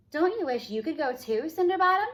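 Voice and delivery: mocking stepsister rising to a high jeer; sneering. A narrator's reading. Older stepsister taunting Cindy, high sneering jeer. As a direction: taunt her, pitch climbing on the nickname. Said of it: "sneered her older sister".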